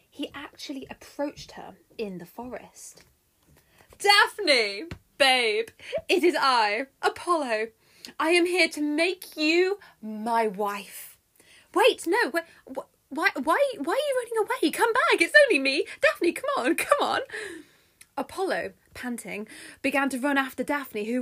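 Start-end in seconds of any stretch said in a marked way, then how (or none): 4.0-11.2 loud, higher, self-important god; wooing Apollo. Acted. Apollo proposes to Daphne in the forest, grand and loud. 11.7-17.2 high, loud, stammering plea; frantic calling out. Acted. Apollo begs fleeing Daphne to come back, frantic and loud.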